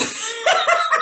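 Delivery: high, bright burst of laughter; real laugh. Real, not acted. Co-host laughing about having no video, high laugh. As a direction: laugh at the awkwardness.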